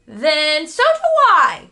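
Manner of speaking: loud and slow, fiercely loyal; loyal cry. A narrator's reading. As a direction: declare it, backing him up. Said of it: "cried Stuart loyally".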